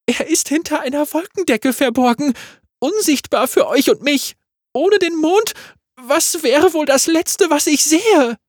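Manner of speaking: viel höher und lauter, große Geste; theatralische Bühnenstimme. Acted. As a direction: spiel für die hintersten Ränge. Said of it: "Große Gesten, damit man auch in den hinteren Rängen". (Magga deklamiert wie auf der Bühne)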